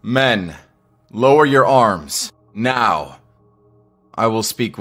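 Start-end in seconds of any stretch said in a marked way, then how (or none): 0.0-3.2 loud, raised military order; commanding. Acted. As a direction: call out an order to the troops. Commander orders his troops.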